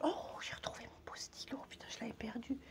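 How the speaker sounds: hushed private whisper; a real whispered aside. Real, not acted. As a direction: whisper it to yourself. Aside while finding her pen pot.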